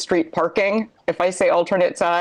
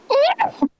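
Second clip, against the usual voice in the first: high squeak of a grabbed kitten. Shown as a demonstration. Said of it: "It's like a kitten sort of being grabbed quickly".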